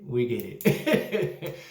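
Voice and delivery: drawn out slowly through a laugh; slow laughing repeat. Real, not acted. Reviewer repeating to Hustle, slow and laughing. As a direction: drag it out, laughing.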